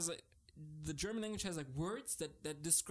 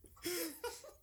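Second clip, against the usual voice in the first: high, softer trailing laughter; helpless laugh; 13 semitones higher, 5 dB quieter than usual. Real, not acted. German speaker still tickled before explaining, helpless laugh. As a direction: let a high, quieter laugh spill out.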